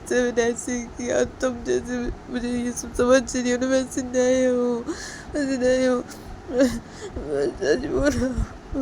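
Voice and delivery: put-on hurt young man swearing he's innocent; pleading boyfriend. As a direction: beg her to believe you, slowly. Sanjay voiced pleading.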